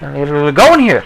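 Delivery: high squeal of ooh, then slow; mock surprised. Feeling a jump in his boot, a mock high ooh then slow words. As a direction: play the jolt of surprise, stretched out. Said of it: "I was like".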